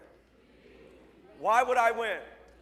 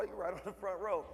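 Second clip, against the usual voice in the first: usual speaking voice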